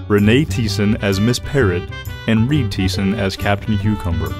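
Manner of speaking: plain reading voice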